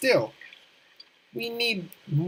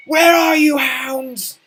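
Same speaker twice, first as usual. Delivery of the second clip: loud taunting call, much higher; villain shout; 9 semitones higher, 8 dB louder, slower than usual. Acted. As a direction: bellow for the hounds. Calling out to hounds in game.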